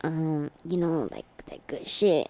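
a hint of a shady street seller; shady dealer voice. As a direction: sell it low like a sketchy dealer. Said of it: "He goes".

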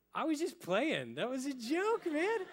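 high, squeaky backing down; nervous backpedal. Retold or imitated. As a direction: squeak out a scared excuse to the gang. Bully backs down before gang.

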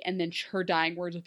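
ordinary talk, nothing marked

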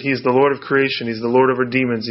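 ordinary talk

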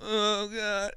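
high, pained moaning; mock queasy groan. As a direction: groan like a carsick friend. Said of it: "He's like".

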